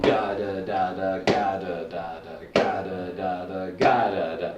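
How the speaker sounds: steady "ga da da da" like eighth notes on a metronome; vocal drum counting. Shown as a demonstration. Teacher voicing eighth notes to a metronome, steady drum syllables. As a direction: chant the beat evenly.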